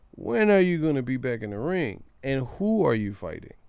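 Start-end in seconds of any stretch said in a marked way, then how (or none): none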